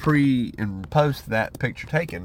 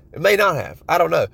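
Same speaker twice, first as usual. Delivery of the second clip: ordinary talk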